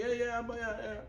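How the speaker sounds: high, run-together yeses; mock dismissive agreement. Son answering Dad's religious questions, breezily dismissive. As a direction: imitate a son brushing off his father. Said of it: "his son always told me".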